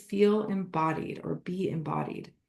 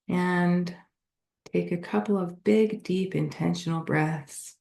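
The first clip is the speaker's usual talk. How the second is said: guided meditation; 3 semitones lower than usual. Acted. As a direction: lead them gently through a body scan, unhurried.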